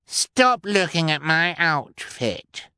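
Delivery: put-on snooty little girl scolding; scornful brat. A narrator's reading. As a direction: order them like a spoiled princess. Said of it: "she commanded the Baudelaire scornfully".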